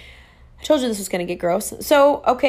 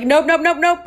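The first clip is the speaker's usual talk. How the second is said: higher and louder, a rapid string of nopes; urgent nopes; 7 semitones higher, 5 dB louder than usual. Retold or imitated. Stopping mid-run, a higher, louder alarmed burst of nopes. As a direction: snap out nope nope nope in alarm.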